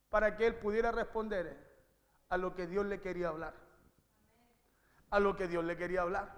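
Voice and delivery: suddenly low and soft, for weight; grave, low pulpit aside; hushed emphasis. Real, not acted. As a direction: drop the voice right down to let the point land. Preacher drops voice for emphasis.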